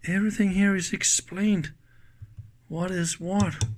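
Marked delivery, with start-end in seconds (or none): none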